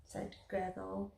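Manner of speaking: plain reading voice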